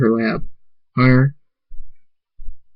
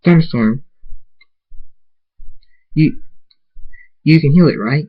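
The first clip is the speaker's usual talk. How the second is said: mildly anxious, higher-pitched appeal; anxious plea; 4 semitones higher than usual. A narrator's reading. Zara asks Thunderstorm to heal her horn, anxious. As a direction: plead with a touch of worry. Pleading to have horn healed.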